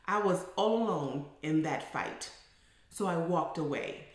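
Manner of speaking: ordinary talk, nothing marked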